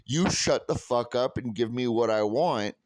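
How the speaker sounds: usual speaking voice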